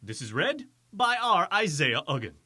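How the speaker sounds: ordinary talk, nothing marked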